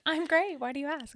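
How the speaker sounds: high-pitched mock 'all fine' voice; feigned cheerfulness. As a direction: pitch it way up, pretending nothing is wrong. Retold server pretending all is fine.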